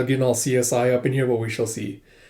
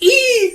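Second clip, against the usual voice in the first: very high, loud "eee"; piercing mock squeal of delight; fangirl squeal; 6 dB louder than usual. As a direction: squeal like excited handmaids. Imitates handmaids squealing at Jae-ha.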